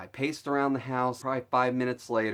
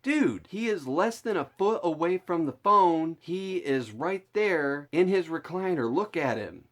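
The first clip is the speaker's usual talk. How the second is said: retold frantic insistence; 6 semitones higher than usual. Arguing with friend that grandpa is dead.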